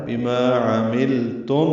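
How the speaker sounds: بما عملتم recited very slowly with a clear meem; slow recitation. Shown as a demonstration. Teacher reciting for students, very slow. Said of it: "میم ساکن پہ اظہار شفوی ہے".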